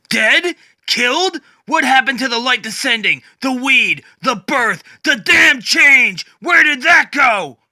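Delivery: high, loud, disbelieving yell; incredulous shout. Acted. Batman shouting in disbelief at Swamp Thing. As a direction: demand answers in outrage.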